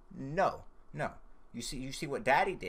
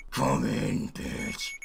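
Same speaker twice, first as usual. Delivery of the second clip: low, menacing growl; aggressive; 3 semitones lower, much slower than usual. Pretend. Sleepless player daring the bear to come in, aggressive growl. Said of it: "I got a little aggressive there".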